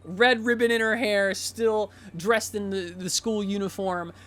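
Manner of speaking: ordinary talk, nothing marked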